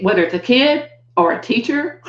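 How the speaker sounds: ordinary talk, nothing marked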